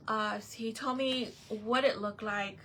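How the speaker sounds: ordinary talk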